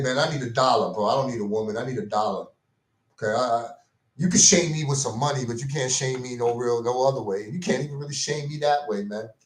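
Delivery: ordinary talk, nothing marked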